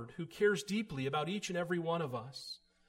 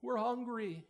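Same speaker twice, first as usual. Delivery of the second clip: higher bleating cry of a hungry sheep; 5 semitones higher, much slower than usual. Acted. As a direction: bleat it out like a starving lamb. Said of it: "their voice cries out".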